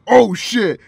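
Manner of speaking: loud amazed outburst. Retold or imitated. Friend reliving a playlist reaction, loud amazed outburst. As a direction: blurt it out like the track just hit you.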